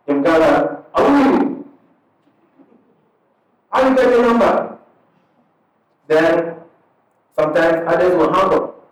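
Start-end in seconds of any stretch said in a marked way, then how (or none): 0.9-4.8 high, suspicious "how are you?" much higher in pitch; startled phone reply. Retold or imitated. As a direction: play a wary stranger picking up an unknown call.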